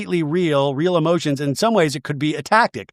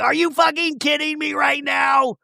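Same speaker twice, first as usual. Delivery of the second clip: high, stretched-out indignant imitation; hammed-up disbelief, pitched way up; mock outraged cry; 11 semitones higher than usual. Retold shouting match.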